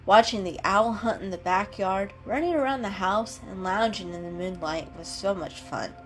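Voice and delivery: plain reading voice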